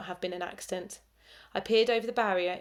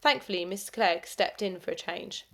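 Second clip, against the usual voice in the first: ordinary delivery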